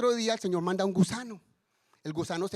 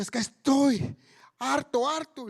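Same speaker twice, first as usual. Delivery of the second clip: higher, fed-up mock outburst; angry Jonah; 5 semitones higher, 3 dB louder, slower than usual. Jonah answering God that he has had enough, angry. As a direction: act out exasperation, pitched up. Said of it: "Y Jonás enojado".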